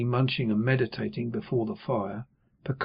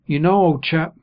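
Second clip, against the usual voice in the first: Joe to Pip; 4 semitones higher, 7 dB louder than usual. A narrator's reading.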